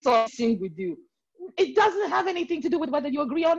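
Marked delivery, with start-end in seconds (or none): 0.0-1.0 put-on high, animated Nigerian accent; Nigerian manner. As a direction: exclaim in exuberant Nigerian style. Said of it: "Like Nigerians".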